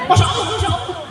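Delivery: very high, loud squealing "Masya Allah"; awed woman. Acted. Fake Bu Nyai squealing in awe as women kiss her hand. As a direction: squeal it like an overwhelmed fake lady.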